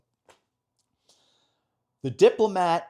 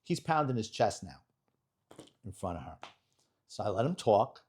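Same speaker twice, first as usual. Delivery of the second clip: normal talking voice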